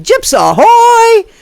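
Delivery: loud, high, drawn-out hail; catchphrase call. Pretend. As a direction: holler it like a sailor's ahoy.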